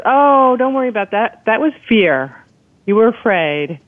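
lightly mocking, high "oh, don't worry"; dismissive reassurance. Doctor explaining away a patient's symptoms, dismissively reassuring.

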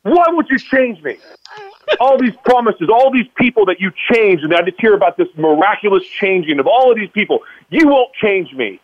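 high-pitched, anguished pleading outcry; retold scream at God. Man demanding answers from God, retold screaming. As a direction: re-enact crying out at God in protest. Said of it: "I start screaming at God".

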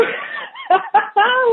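a big, high burst of real laughter. Real, not acted. Bursts out laughing after wow.

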